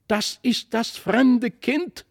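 put-on high, slow, stretched childlike cry; excited calling out. A narrator's reading. Christlieb spots the strange child in the clouds, calling out. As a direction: call it out high in wonder. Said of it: "rief Christlieb".

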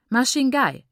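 loud, sharp call of the wife's name; commanding. A narrator's reading. Father summoning his wife, loud command. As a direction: order her to attention. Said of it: "befahl er meiner Mutter".

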